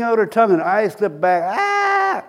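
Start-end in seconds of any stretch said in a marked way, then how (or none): 1.6-2.2 high-pitched mock scream; imitated yell. Preacher acting out the woman held by ushers, high scream. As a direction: imitate a possessed shriek, high and sustained. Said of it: "she starts yelling, top of her voice".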